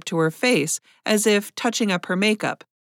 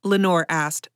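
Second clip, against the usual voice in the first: ordinary delivery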